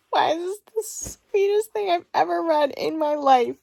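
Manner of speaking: high, loud gush of delight; overwhelmed gush. Real, not acted. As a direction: swoon over the sweetest line she's read. Moved by the book's passage.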